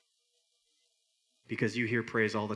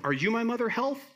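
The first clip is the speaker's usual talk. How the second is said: high-pitched, searching bird voice; little bird; 11 semitones higher, 4 dB louder than usual. Acted. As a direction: climb high on the question like a lost chick. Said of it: "this little bird who falls out".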